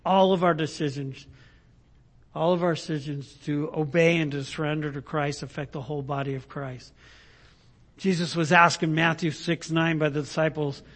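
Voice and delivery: ordinary talk, nothing marked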